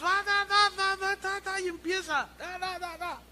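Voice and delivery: high, rapid mock babble of tongues; speaking in tongues. Preacher mimics a visitor prophesying in tongues, high babble. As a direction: imitate the woman babbling in tongues, pitched high. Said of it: "empieza a profetizar en lenguas".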